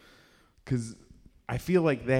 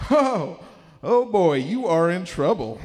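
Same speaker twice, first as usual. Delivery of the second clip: put-on high loud sing-song oh boy; mock stern parents; 6 semitones higher, 6 dB louder, slower than usual. Parents greet son home at four thirty, mock ominous. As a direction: tease the trouble he's in.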